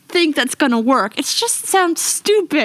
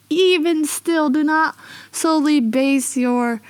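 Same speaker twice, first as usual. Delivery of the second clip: ordinary talk, nothing marked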